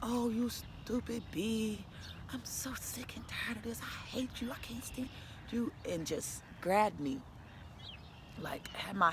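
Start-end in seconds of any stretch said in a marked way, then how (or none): 0.0-5.7 slow then whispered, mimicking his fury under her breath; a whispered imitation of an angry partner; hushed retold rant. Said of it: "he flipped the lid".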